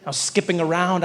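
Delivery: ordinary talk, nothing marked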